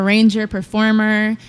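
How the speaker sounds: ordinary talk, nothing marked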